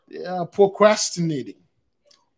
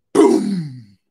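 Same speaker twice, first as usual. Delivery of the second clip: imitated boom; 5 semitones lower than usual. Imitating an explosion sound.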